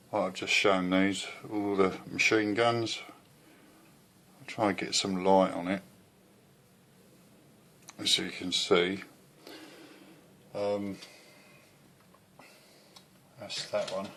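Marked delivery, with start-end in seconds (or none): none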